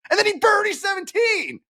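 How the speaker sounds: high-pitched excited cry; soaring, thrilled payoff to the story; excited exclamation. Real, not acted. Retelling dramatic birdie turnaround.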